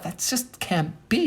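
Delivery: high, slow protest; hammed-up refusal to believe it; disbelieving. Retold disbelief at the claim.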